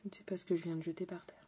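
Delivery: fast, breathy whisper under the breath; hushed, rushed aside. Real, not acted. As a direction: whisper it quickly to yourself. Mutters while picking something up.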